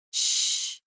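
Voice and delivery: soft unvoiced shush; hushing. A narrator's reading. She hushes her grieving lover, soft shush. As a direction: hush him gently, barely a breath.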